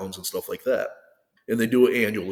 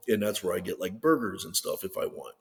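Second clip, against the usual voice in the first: ordinary delivery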